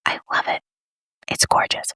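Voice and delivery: soft whisper over makeup. Real, not acted. Vlogger whispering to viewers about her lipstick, hushed. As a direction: breathe it out close to the mic. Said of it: "Why am I whispering?".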